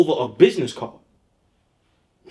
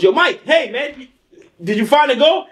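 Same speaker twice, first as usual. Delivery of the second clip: high, eager call; high excited voice; 9 semitones higher than usual. Retold or imitated. Aliko calling out to Mike, high and eager. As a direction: hail him excitedly.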